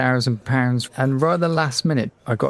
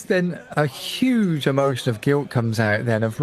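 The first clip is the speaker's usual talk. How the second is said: normal talking voice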